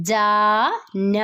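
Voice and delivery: ജാന drawn out syllable by syllable, very slowly; slow spelled reading. Shown as a demonstration. Teacher to students, sounding out a Hindi word very slowly. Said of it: "okay, വായിച്ചോളൂ".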